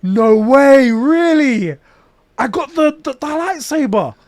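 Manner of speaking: high, loud, drawn-out disbelief; retold amazement. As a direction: gush in stunned delight, stumbling at the end. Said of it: "I'm amazed".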